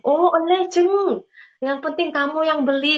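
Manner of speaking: high, lively acted agreement; retold eager consent. Landowner friend agrees to sell to speaker, eager consent.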